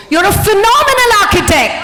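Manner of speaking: high, loud, rousing; shouted affirmation. Shown as a demonstration. Coach shouting praise for the architect to echo. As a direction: hype her up.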